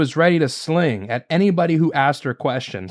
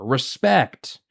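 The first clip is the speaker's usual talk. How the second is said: normal talking voice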